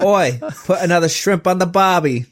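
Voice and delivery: high, broad mock Aussie voice, hamming up the ad cliché; mock Australian accent. To his friend, joking about Foster's, in a mock Australian accent. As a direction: toss it off like a cheesy Aussie beer ad.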